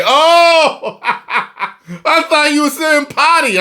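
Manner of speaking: high, drawn-out "oh" breaking into laughter; laughing realisation. Retold or imitated.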